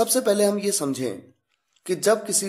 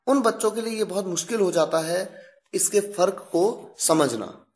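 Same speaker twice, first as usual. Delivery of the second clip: ordinary talk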